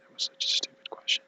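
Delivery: hushed, shaken whisper; a whispered apology. Real, not acted. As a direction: breathe the words out, ashamed. Apologizing in shock on phone.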